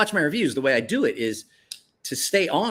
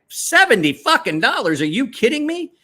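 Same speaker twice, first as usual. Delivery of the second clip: stunned disbelief; 12 semitones higher, 3 dB louder than usual. Retold or imitated. Looking up the price, he cries out in high disbelief. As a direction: pitch soaring high, incredulous and louder. Discovering the knife's low price.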